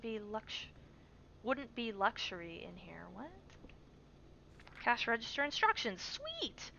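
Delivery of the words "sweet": very high gleeful sweet; excited squeal. Real, not acted. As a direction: squeak with delight. Finds register instructions.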